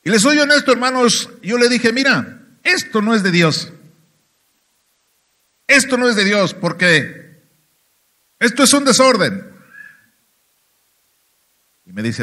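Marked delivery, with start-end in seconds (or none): 1.9-9.5 louder retold objection; retold protest. Churchgoer objecting to his pastor about the tongues, raised protest.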